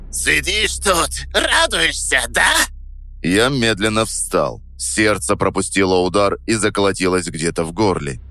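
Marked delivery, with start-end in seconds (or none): 0.1-2.5 put-on high, slow drunken squeal; piggish squeal. A narrator's reading. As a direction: squeal it, voice cracking. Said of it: "голос срывался на какой-то поросячий визг". (поросячий визг)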